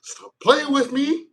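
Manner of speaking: high, slow whine; high pleading whine, drawn out; whiny demand. Retold or imitated. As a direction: plead like a jilted lover. Scorpio voiced demanding.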